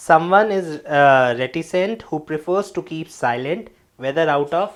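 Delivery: slow, deliberate read-aloud of a definition; teacher reading a definition aloud; reading from the book. Shown as a demonstration. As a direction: read the textbook line out clearly to the class.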